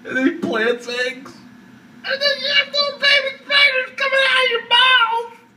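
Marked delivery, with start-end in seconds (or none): 2.0-5.5 very high wailing cry; crying panic. Real, not acted. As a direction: wail in hysterical fear. Panicked fantasy, voice cracking.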